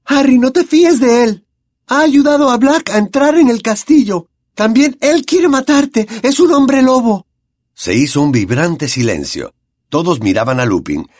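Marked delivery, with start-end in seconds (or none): 0.0-7.3 put-on much higher and louder, urgent girl's voice; Hermione frantic warning. A narrator's reading. Hermione warns Harry against Lupin, much higher and louder. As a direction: warn him, desperate and shrill.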